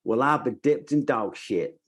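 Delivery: American Southern twang; put-on US Southern accent. Shown as a demonstration. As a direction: say it as a Southern spark phrase. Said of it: "for a Southern accent".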